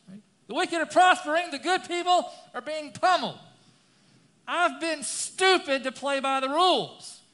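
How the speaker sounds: loud, high, impassioned scripture reading; preaching at full force. Acted. Preacher reads the psalm loud to the church. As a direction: thunder out the psalm to the congregation.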